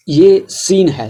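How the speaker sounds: ordinary delivery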